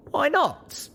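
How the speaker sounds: stung, wanting to know why; high, wounded demand; hurt question. A narrator's reading. Young pony hurt by answer.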